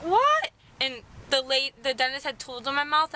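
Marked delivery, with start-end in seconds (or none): 0.0-0.5 surprised. Retold or imitated. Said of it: "I was like".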